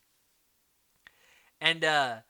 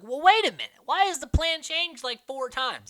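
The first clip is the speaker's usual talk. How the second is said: baffled question; 9 semitones higher, 6 dB louder than usual. Retold or imitated. Elaine confused by plan changes.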